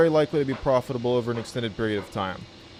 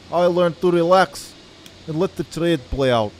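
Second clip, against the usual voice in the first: hammed-up Russian accent, pitched higher; mock Russian accent; 4 semitones higher than usual. YouTube trader opening his video to viewers in a put-on Russian accent. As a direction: lay on a thick joke Russian accent to tease nervous traders. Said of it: "I can't do this accent the whole call".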